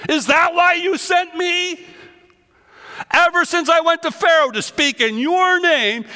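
loud, high pleading complaint; anguished protest. Acted. Moses protesting to the Lord, loud and high. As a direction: cry it out, wounded and loud.

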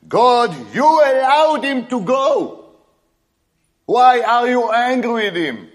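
indignant prophet protesting; complaining Balaam. Acted. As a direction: whine at God like an offended prophet. Voicing Balaam complaining to God.

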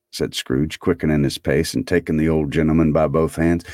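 ordinary delivery

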